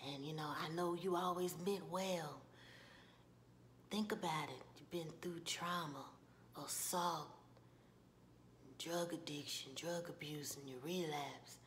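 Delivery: low, hushed, halting sympathy; Ralph Angel. Retold or imitated. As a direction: list her troubles gently with long pauses. Voices Ralph Angel consoling Darla.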